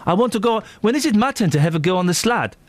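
South African whine for a turn; South African accent. Acted. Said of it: "I'm doing a South African".

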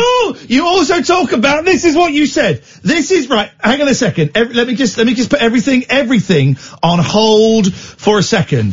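high, gleeful gotcha voice; incredulous pounce. Real, not acted. Host catches his co-host's slip, high and gleeful. Mock outrage, much higher.

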